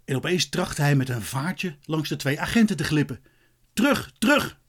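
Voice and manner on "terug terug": high-pitched mock shout; high urgent command; shouted order. A narrator's reading. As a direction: call out sharply, much higher. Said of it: "schreeuwde er een".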